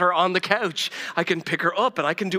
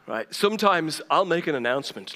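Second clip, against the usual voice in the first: ordinary talk, nothing marked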